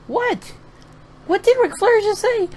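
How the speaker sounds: can't believe your ears; incredulous high-pitched what; stunned disbelief. Real, not acted. Stunned by Ric Flair's mic segment.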